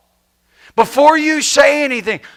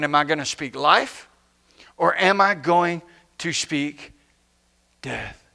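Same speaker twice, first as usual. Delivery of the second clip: much lower and softer, fading on death; solemn hush; 7 semitones lower, 8 dB quieter than usual. Acted. Preacher poses the choice to the congregation, solemn hush. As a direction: let death drop almost to nothing.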